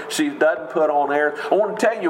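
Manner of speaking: ordinary talk, nothing marked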